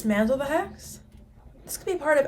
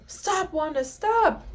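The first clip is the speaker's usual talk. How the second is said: high, rising cry to stop; urgent plea; 8 semitones higher, 4 dB louder, much slower than usual. Real, not acted. Viewer pleading with Wanda on screen, urgent. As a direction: call out urgently, louder on the last word.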